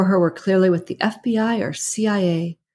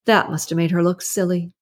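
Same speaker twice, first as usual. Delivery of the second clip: plain reading voice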